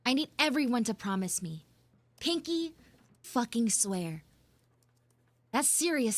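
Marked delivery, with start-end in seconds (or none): none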